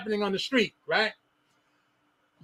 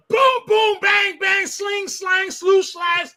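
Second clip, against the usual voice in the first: bang them out like hits landing; shouted beating noises; 7 semitones higher, slower than usual. Retold or imitated.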